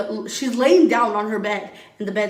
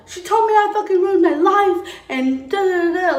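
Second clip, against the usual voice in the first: higher, louder mock rant; going ballistic; 9 semitones higher, 6 dB louder than usual. Daughter ranting to her baby daddy, mock furious yell. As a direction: retell it like you're losing it again, loud and high. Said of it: "I was just going ballistic".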